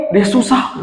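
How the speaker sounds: usual speaking voice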